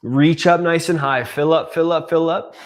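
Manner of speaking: loud, bright cue to breathe in big; energetic coaching. Shown as a demonstration. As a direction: pump them up to fill their lungs. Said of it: "coach cueing a big inhale".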